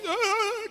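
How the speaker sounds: a sudden, very high startled yelp; startled gasp. Retold or imitated. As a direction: jump at the knock on the window with a high "Ah". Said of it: "kinda thing".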